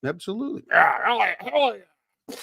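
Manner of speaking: high, eager yes; hammed-up hell yeah; enthusiastic general. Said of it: "And he said".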